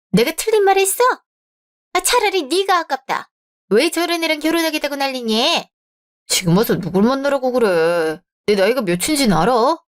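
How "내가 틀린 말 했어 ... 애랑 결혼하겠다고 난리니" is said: high, pitched-up huffy complaint; mother venting her outrage to her son; shrill-free but high, offended mother; indignant rant. Acted. Mother rants after bride leaves.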